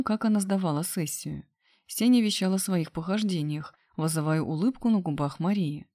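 ordinary delivery

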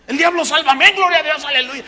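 mocking the automatic crowd; mock shouted amens. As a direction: shout praise mindlessly. Imitating mindless amens.

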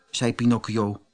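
plain reading voice

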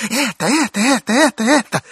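high, loud excited cry. Acted. Old gentleman crying out excitedly at the door. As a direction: blurt it out, thrilled.

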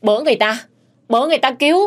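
high, loud alarmed call; acted shout for rescue, pitch climbing; crying out for help. A narrator's reading. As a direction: cry out to bring everyone running. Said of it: "Nó kêu là ú ớ".

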